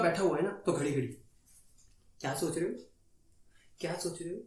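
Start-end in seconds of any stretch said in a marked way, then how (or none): 2.2-4.4 soft, questioning imitation with a little lift at the end; quietly prod, as if checking on someone; mock prying partner. Girlfriend keeps asking the silent man his thoughts, soft mock prying.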